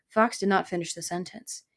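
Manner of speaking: usual speaking voice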